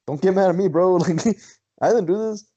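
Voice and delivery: high, defensive plea with a laugh; retold protest. Telling angry Bender it was not him, mock defensive.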